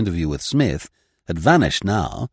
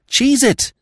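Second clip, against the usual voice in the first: sharp; 5 dB louder than usual. Acted. Liz silences him.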